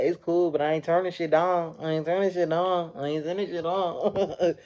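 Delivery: high, loud mock defiance, slowing and breaking into laughter; defiant Coquilla. Coquilla refusing to back down, high mock-defiant repeats. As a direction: ham up her refusal, laughing by the end. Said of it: "That shit had me dying".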